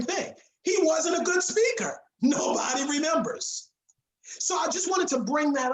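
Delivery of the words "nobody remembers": usual speaking voice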